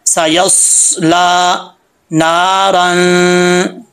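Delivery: very slow, sustained teaching recitation of सयस्ला नारन. Shown as a demonstration. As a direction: hold the vowels and final hum of नारन. Teacher demonstrates slow Quran recitation. (नारन को खींचकर, गुन्ने के साथ)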